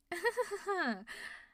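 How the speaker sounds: dark high laugh with a threat in it; menacing laugh. Acted. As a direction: laugh like something snapped. Menacing laugh at the boss's deeds.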